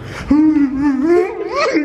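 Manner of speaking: loud, high-pitched laugh. Real, not acted. Friends joking around at the door, high laughing. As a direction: burst into a long, high laugh. Laughing during prank lockout.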